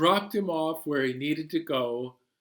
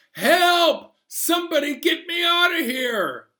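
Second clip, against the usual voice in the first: high, loud panicked yell; yelling for help; 6 dB louder than usual. Retold or imitated. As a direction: shout for help. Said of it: "and I yell".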